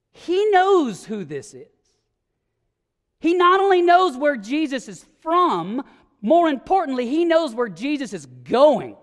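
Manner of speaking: rising pulpit fervour; full-force preaching. Acted. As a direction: preach it, pressing every point.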